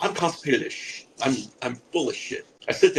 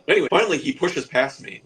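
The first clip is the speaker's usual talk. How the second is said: ordinary delivery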